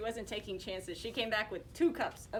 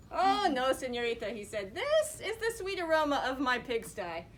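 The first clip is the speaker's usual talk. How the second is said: pig suitor; 5 semitones higher, 8 dB louder than usual. Acted. Pig boasting of his sty.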